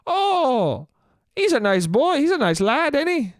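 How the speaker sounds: put-on high, swooping caricature Irish accent; exaggerated Irish lilt. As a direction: ham up a singsong Irish bartender. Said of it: "that was an Irish accent".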